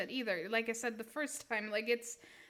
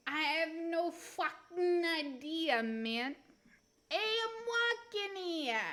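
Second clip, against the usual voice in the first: hammed-up New York accent, drawn out and rising high; stretched, mock New York accent; 4 semitones higher than usual. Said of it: "Inner New York".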